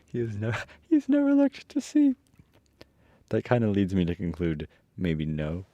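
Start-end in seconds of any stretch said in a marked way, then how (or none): 0.9-2.1 chuckling high lion imitation; mimicked lion. Reader mocking the lion's answer, amused falsetto imitation.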